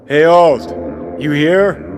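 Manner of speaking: loud call into an empty room. Acted. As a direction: shout for Alt, checking if she's there. Calling out for Alt.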